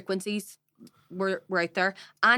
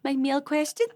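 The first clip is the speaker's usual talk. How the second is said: much higher, baffled innocent voice; imitating Bridge; 10 semitones higher than usual. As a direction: play her as clueless and bewildered. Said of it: "Bridge is just like".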